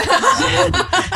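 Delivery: short burst of high laughter. Real, not acted. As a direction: crack up at the teasing. Risa tras la broma. (risa aguda y repentina)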